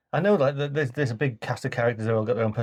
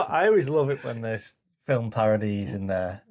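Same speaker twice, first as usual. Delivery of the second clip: usual speaking voice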